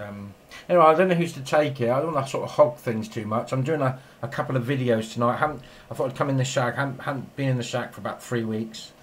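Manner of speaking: normal talking voice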